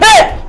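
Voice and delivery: sudden high, loud shriek; real jump-scare cry; startled yelp. Real, not acted. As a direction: yelp in fright, cut short. Startled mid-Batman by game.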